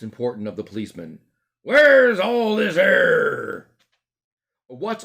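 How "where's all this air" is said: slow, loud, high constable drawl; drawn-out official query; policeman. Retold or imitated. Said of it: "he said it like this".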